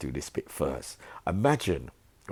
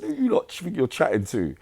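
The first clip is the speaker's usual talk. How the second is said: higher, louder mock challenge to the gang; defiant challenge; 10 semitones higher, 5 dB louder than usual. Yummy answering the three men, retold defiance. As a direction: fling it back at them, high and loud. Retelling a prison confrontation.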